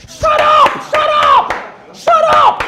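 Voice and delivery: high, loud, repeated shout; shouted rebuke. Acted. Preacher silencing those who wish they were Peter, shouted rebuke. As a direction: cut them off with a full-voiced yell.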